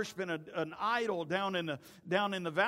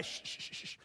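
repeated sharp "shh"; hushing; 6 dB quieter than usual. Retold or imitated. Jesus quieting the arguing crowd, insistent shushing. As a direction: hush a noisy crowd.

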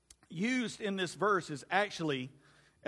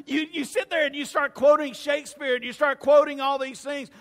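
laughing through the words, louder and higher; giggling at his own joke as he talks; 7 semitones higher, 8 dB louder than usual. Real, not acted. Preacher to congregation, laughing while teasing himself.

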